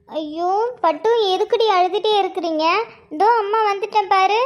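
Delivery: high, cooing mother voice; soothing baby talk. Acted. Mother arriving to comfort her crying child, soothing baby talk.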